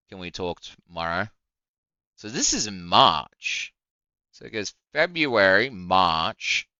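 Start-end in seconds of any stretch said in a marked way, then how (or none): none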